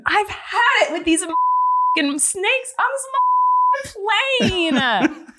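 high, drawn-out, over-the-top exasperation; hardcore movie read. Acted. Host redoing a movie quote for laughs, hammed-up exasperated yell. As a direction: build to a hammed-up yell on the last word. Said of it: "Like, do it hardcore".

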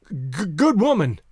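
slow, stammered opening in a disguised voice; faltering attempt to disguise the voice. A narrator's reading. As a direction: stumble on the first word, nervously. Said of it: "he said in faltering tones".

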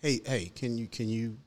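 a sheepish quiet plea to a stranger; timid request. Pretend. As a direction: tiptoe around the parent, hushed and polite. Timidly asking parent about crying kid.

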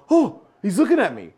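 retold starstruck panic. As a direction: re-enact a flustered fan being noticed. Retells nervous starstruck moment.

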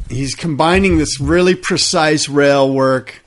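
ordinary talk

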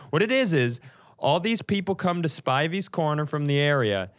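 normal talking voice

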